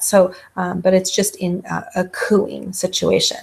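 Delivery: ordinary delivery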